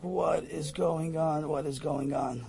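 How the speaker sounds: soft, slow bewildered muttering; quiet stunned repetition; dazed murmur. Retold or imitated. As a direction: drift off into disbelief. Dazed at the plane window.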